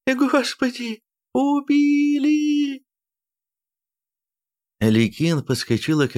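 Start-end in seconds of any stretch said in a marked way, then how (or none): 0.1-2.8 drawn-out piercing wail; screaming. A narrator's reading. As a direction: wail it out, horrified. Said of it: "истошные женские крики".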